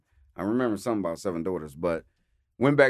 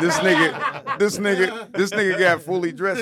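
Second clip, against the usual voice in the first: laughing; 7 semitones higher, 7 dB louder than usual. Real, not acted. Cracking up at Mike dressed up.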